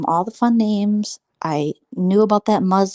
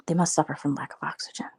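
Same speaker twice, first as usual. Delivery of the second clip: soft unvoiced whisper; whispered aside; 4 semitones lower, 6 dB quieter than usual. Real, not acted. Snide aside to the chat about the women, whispering. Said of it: "whispered aside".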